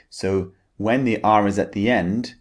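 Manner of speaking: ordinary talk, nothing marked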